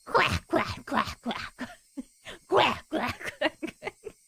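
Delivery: high, giggly duck quacks through a laugh; silly duck-quack laughter. Acted. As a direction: cackle like a duck, breaking into laughs. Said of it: "laughed together with a chicken laughter".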